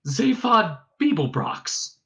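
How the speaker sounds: high, slow, stunned echo; weak astonishment. A narrator's reading. Ford stunned by the robot's news, weakly. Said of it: "he said weakly".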